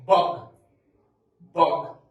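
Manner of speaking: Bug said loud with a short uh; loud model word. Shown as a demonstration. Teacher contrasting bug with bag and big. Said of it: "it would be what".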